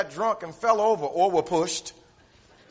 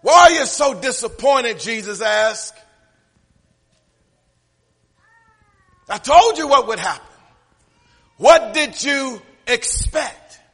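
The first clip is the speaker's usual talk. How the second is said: louder, higher voice of Jesus questioning; preached Jesus voice; 5 semitones higher, 11 dB louder than usual. Acted. Jesus asking Mary why she cries, in a louder preached voice. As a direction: press the questions home.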